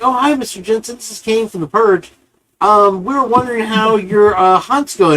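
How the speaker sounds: high, sugary put-on phone voice; mock-friendly prank-call voice; fake polite caller. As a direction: play the innocent caller to catch him out.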